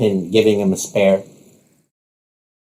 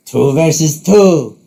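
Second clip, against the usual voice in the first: higher, drawn-out announcement; theatrical correction; 5 semitones higher, 4 dB louder, slower than usual. Acted. Rook drops Bishop from the teams, theatrical. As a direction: revise it with showman flourish.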